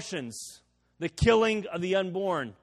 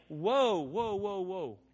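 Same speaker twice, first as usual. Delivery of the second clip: casual whoa; much slower than usual. Shown as a demonstration. Preacher showing what Jesus did not mean, casual trailing whoa. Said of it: "He's not saying".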